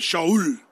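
"Shaul" with a sh sound, two syllables sha-ul; Hebrew pronunciation. Shown as a demonstration. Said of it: "That's how they say it".

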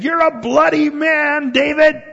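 high, loud accusing yell; mock yell. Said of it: "he starts yelling at David".

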